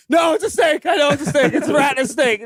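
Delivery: high laughing shouted insistence; laughing mock panic. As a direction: insist on the snake through laughter. Insisting it's a snake.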